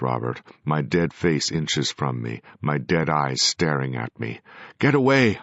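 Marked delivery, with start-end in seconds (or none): 4.8-5.4 high, loud shout. A narrator's reading. A ghost shouting at his own corpse, loud. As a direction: yell to push it off. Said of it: "I shouted".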